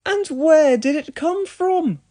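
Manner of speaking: puzzled call. A narrator's reading. James wondering aloud.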